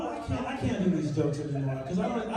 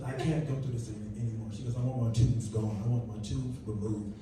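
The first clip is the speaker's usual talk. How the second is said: quiet wife; 10 semitones lower, 4 dB quieter than usual. Retold or imitated.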